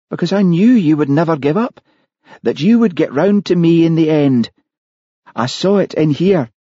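high, emphatic woman's voice; confessing murderess, insistent. Acted. Killer tells Hamish why she targeted him, high insistent voice.

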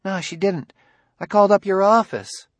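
put-on high boyish voice, anxious; young boy, eager to explain; child voice. A narrator's reading. Narrator voices the boy Ben.

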